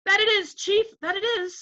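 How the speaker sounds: high-pitched yes-man chorus; eager agreement. Acted. Others echo the chief, eager high agreement.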